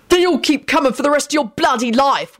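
high, heated angry shouting. A narrator's reading. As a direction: shout back furiously in a high-pitched voice. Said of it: "William shouted".

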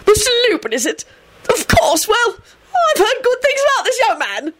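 high-pitched forced heartiness; false conviviality. A narrator's reading. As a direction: greet him with overdone, high-voiced joviality. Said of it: "a voice of false conviviality".

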